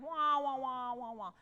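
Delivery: a light muted-trombone wah wah; Charlie Brown teacher. Shown as a demonstration. The band director imitates the Charlie Brown teacher lightly. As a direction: mimic the cartoon teacher's wah. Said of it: "like the Charlie Brown teacher".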